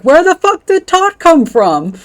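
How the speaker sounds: high, loud, slow disbelieving shout; mock outrage at English grammar; exasperated yell. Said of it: "And he's like".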